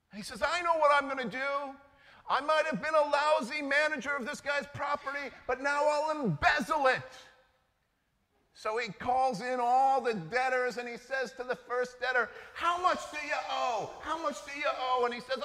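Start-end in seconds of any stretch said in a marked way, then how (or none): 0.4-7.0 louder, higher, gleeful plotting; scheming glee. Acted. Preacher voicing the manager who plans to embezzle, louder gleeful scheming. 12.5-15.0 calling out. Acted. Manager calling in debtors.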